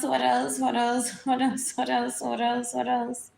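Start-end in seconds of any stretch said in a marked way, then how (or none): none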